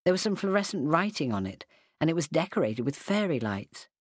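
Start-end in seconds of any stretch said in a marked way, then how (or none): none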